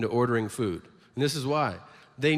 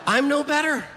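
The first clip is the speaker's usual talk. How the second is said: high mock confession; 12 semitones higher, 6 dB louder than usual. Comic admitting own guilt.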